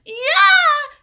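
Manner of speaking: very high, loud crying yell; pained scream. A narrator's reading. Leslie screams as Paul yanks her pigtails, high and loud. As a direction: scream in pain. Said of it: "she screamed".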